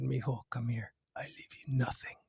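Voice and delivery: low, soft, whispered grandfather; weak dying old man, hushed; faint deathbed voice. Retold or imitated.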